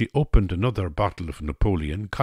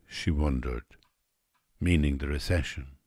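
ordinary delivery

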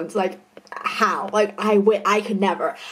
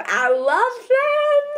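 excited gushing; 13 semitones higher, much slower than usual. Real, not acted. Gushing about favourite show.

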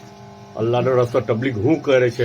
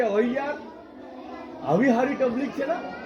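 much higher, gushing quote; mock-excited villager praising tabligh; delighted exclamation; 10 semitones higher, 5 dB quieter than usual. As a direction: gush like someone newly in love with it. Imitates someone delighted by tabligh.

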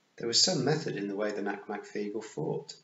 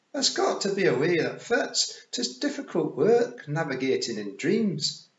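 high, slow Scots pictsie; Rob Anybody; 7 semitones higher, 6 dB louder than usual. Acted. Rob explaining navigation in dreams, high Scots pictsie voice.

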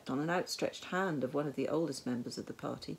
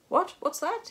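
high, startled outburst; sudden high-pitched alarm; startled cry; 13 semitones higher, 7 dB louder than usual. A narrator's reading. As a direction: cry out as if jolted awake. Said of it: "he cried out".

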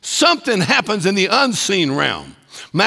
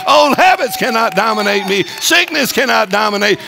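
louder, higher, building to a shouted declaration; full-force preaching; 5 semitones higher, 4 dB louder than usual. Acted. Hagin answering the fearful preacher, building full-force preaching. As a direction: defy the devil out loud.

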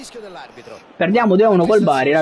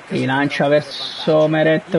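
usual speaking voice

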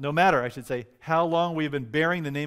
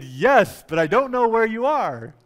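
loud, high shouted answer; raised voice, calling to someone unseen; calling back; 9 semitones higher, 8 dB louder, slower than usual. Retold or imitated. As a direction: holler back across the dark.

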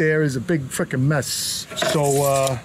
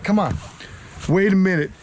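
ordinary talk, nothing marked

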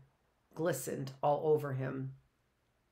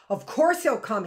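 much louder, higher, insistent; too loudly; 6 semitones higher, 9 dB louder than usual. A narrator's reading. Matt answers Sackness angrily, far too loudly. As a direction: overcompensate with volume. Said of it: "too loudly".

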